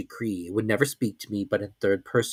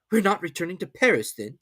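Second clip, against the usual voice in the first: servant's voice; 8 semitones higher than usual. A narrator's reading. Servant questions.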